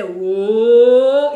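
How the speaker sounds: a big startled Jamaican whoa; loud shocked whoa. Retold or imitated. Party crowd gasping at the scene, loud shocked whoa. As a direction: gasp it out loud at the sight.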